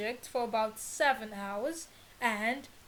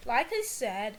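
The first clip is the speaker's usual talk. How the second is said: usual speaking voice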